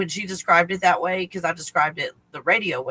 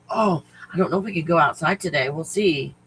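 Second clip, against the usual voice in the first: ordinary delivery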